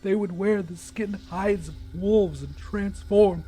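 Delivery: a lightly voiced jittery young man; half-voiced scared friend asking in the dark; hint of a nervous young man; nervous Vern. Vern explains werewolf lore.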